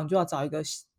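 normal talking voice

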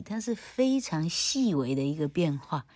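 ordinary talk, nothing marked

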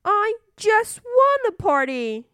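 high, loud insistent demand; bossy little princess. Acted. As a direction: stamp your foot like a spoiled princess. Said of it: "said the little princess".

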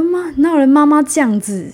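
high, loud angry protest; angry complaint. Retold or imitated. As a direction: scold mom in frustration. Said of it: "越来越生气".

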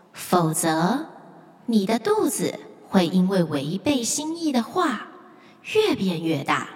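a calm spirit from the deep; mysterious lake spirit. Acted. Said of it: "湖底传来了一个神秘的声音".

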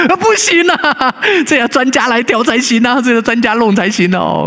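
mock fussy kid. Pastor imitates the boy refusing a quick glue fix, in a high fussy voice. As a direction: whine that only an expert may fix it. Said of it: "他说".

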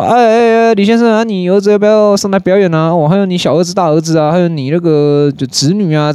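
lightly voiced shop boss calling across the shop; calling out to dad. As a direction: shop boss calls out to Mr. Li, high and eager.

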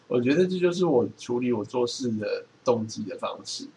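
ordinary delivery

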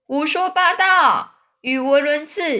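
loud, high, stretched-out scoffing; indignant exclamation, drawn out; outraged chorus. A narrator's reading. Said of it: "大家全部异口同声地说".

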